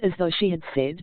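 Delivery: normal talking voice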